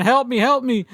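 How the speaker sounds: mock cry for help. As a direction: imitate a panicked call for help without screaming. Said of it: "screaming hysterically for help".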